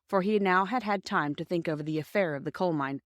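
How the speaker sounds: usual speaking voice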